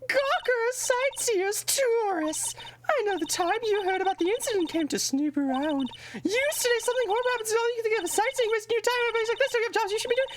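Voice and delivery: very high, shrill-pitched mock Queen guard. Streamer voicing the security guard lady as the Queen. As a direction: screech it out like a pompous old queen. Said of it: "we're giving her the Queen voice".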